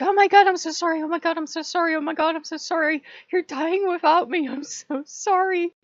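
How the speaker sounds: high, loud, rushed frantic apology; frantic Loki. Retold or imitated. Loki racing back, apologizing in a high frantic gush. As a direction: gush sorry in a panic, voice way up. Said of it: "he's like".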